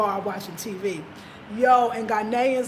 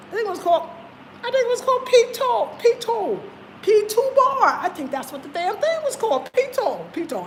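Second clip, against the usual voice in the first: high, searching tone for the bar's name; puzzled, lifted voice trying to recall a name; unsure, high voice; 9 semitones higher than usual. Real, not acted. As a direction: raise the voice high while fumbling for "Pito".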